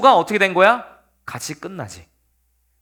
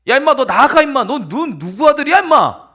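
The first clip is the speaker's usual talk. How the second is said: loud, high acted shouting; angry king; 4 semitones higher, 6 dB louder than usual. Enraged king throwing his son out, angry yell. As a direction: yell at him to get out, playing furious. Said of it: "사울이 화가 나고".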